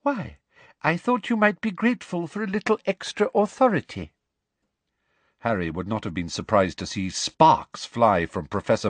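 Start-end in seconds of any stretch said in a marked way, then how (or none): 0.0-4.1 high, sugary condescension; simpering false kindness; sickly sweet. Acted. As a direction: feign innocent helpfulness. Sickly smiling Umbridge offers help.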